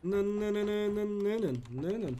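slow taunting singsong; mocking nyah-nyah. Teasing the chat with a slow mocking nın nın chant. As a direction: drawl it out like a childish taunt.

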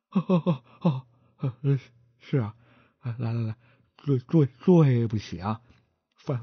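stammering, tripping over every word; a nervous stutterer begging to lead the way. Acted. 结巴的伙计结结巴巴地求着带路.

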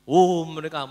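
ordinary talk, nothing marked

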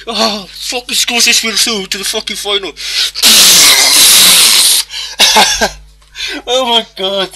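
high, loud screaming with laughter; ecstatic screaming. Real, not acted. Gamer reaching the cup final, ecstatic screaming. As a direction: scream with joy at the winning goal. Winning the shootout.

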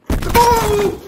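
high scream of fright; sudden shriek at a jump scare; startled scream. Real, not acted. Player scared by a loud spirit, screaming.

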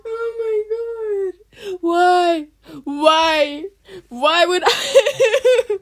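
high, loud, slow crying why; wailing lament. Acted. Girl sobbing about her own haircut. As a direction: wail over her lost hair.

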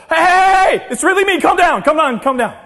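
high, loud, urgent holler; shouting to calm panic. Acted. Preacher as Jesus reassuring startled disciples, urgent shouting. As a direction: get through to people freaking out.

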